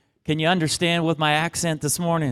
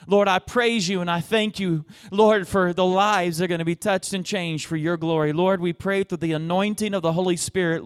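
raised-pitch, flowing public prayer; fervent prayer; 4 semitones higher than usual. Real, not acted. Preacher thanking the Lord for this church, fervent prayer.

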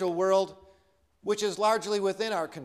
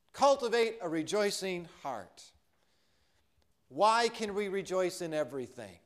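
ordinary delivery

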